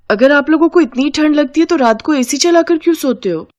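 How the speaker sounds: high-pitched, puzzled daughter-in-law; Anjali. Acted. (हैरान बहू की ऊंची आवाज़)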